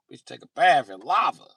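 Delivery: ordinary delivery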